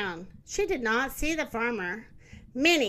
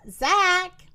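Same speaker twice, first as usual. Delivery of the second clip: louder, higher storybook call; short, raised call; 5 semitones higher, 6 dB louder than usual. A narrator's reading. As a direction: call the name out to a friend. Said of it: "Moo called".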